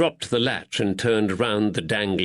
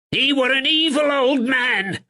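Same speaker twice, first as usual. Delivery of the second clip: high, loud, bitter outburst; angry draper; 5 dB louder than usual. Acted. Draper rails at the blackmailer to the constable, high and angry. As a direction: spit out his grievance. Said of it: "Thatcher's voice rose with anger".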